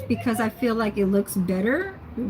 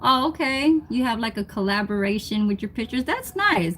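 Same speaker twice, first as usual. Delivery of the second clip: ordinary talk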